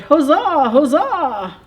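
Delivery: loud triumphant huzzah; cheering bees. A narrator's reading. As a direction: bees cheering her upward. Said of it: "The bees said".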